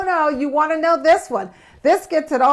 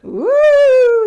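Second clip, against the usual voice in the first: excited ooh; 12 semitones higher, 10 dB louder than usual. Real, not acted. Excited reaction to topic.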